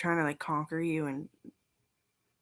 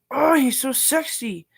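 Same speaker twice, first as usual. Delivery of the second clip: higher, louder swoon; gushing; 8 semitones higher, 10 dB louder than usual. Retold or imitated. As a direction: gush over him like a fan. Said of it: "they're like".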